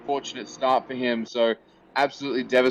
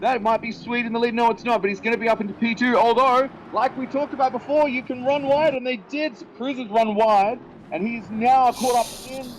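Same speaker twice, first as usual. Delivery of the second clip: much higher, fast, urgent race call; live play-by-play; 10 semitones higher than usual. Acted. Commentator calls the race start live for viewers, higher and faster.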